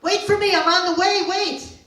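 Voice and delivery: high, loud desperate calling. Retold or imitated. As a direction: shout after her to hold on. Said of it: "I'm calling out loud to my mother".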